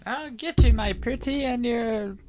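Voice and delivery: high, cackling witch voice; high wicked witch threat. Pretend.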